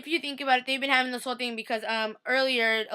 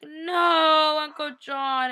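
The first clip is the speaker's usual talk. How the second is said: high wailed no, easing off; mock grief; 5 semitones higher, 5 dB louder, much slower than usual. Fan mourning John's death, mock-grieving wail. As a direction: lament in hammed-up sorrow. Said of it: "And I was so sad".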